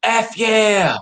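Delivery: high whooping mock yell; imitated celebratory yelling. Imitating the guy yelling across Lowe's parking lot, whooping. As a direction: imitate a jogger whooping, building to a loud woo. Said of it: "he started yelling".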